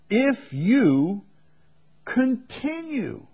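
high, slow continue, falling at the end; stressed continue. Real, not acted. Preacher lifting the key word for the congregation, high and slow.

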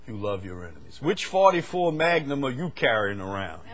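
ordinary talk, nothing marked

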